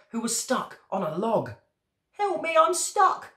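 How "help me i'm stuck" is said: high, drawn-out frog cry for help; stranded frog shouting for rescue; shouted plea. A narrator's reading. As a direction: call out slowly in a high-pitched frog voice. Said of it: "the frog shouted down".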